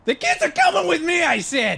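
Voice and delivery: high loud demand; shouting. Acted. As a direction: yell it through the door. Acted quarrel through door.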